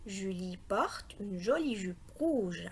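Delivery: whole sentence in one slow, connected phrase; joined-up reading. Shown as a demonstration. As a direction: read it slowly without stopping.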